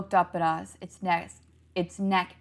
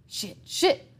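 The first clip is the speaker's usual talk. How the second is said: higher panicked cry; shouting; 8 semitones higher, 3 dB louder than usual. A narrator's reading. Girl slipping off roof shouts in panic. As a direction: blurt it out as she slips. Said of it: "Molly shouted".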